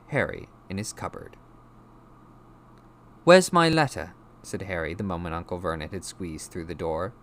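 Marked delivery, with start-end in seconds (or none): 3.2-4.1 high, loud demand; demanding boy. A narrator's reading. Boy confronting uncle about his letter, loud demand. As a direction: confront him with the question.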